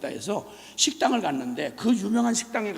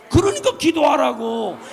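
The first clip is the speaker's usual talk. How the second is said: 그러니까 기도하라고 shouted louder, falling hard; shouted command; 5 semitones higher, 9 dB louder than usual. Real, not acted. Preacher urging the congregation to pray, shouted command. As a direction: bark the call to prayer.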